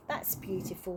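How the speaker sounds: softly, a touch choked up; hushed, understated emotion; holding back tears. A narrator's reading. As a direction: say it quietly, as if trying not to cry. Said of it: "trembling and trying not to cry".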